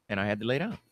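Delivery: ordinary talk, nothing marked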